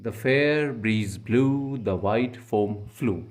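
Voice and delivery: each word drawn out slowly, stressed beats; very slow, stressing every beat; slow poetic reading. Shown as a demonstration. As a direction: read the line like a chanting poet, very slow. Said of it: "आप इस line को पढ़ के देख लीजिए".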